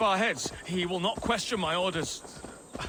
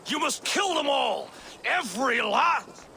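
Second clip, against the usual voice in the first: shouted order; 6 semitones higher than usual. Pretend. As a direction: bark out a cruel order. Double overplays cruel order.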